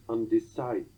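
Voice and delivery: "undecided" cut off as un-de-CIDE; cut after two-thirds. Shown as a demonstration. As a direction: break "undecided" off after the stressed third syllable. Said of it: "two-thirds of a single word".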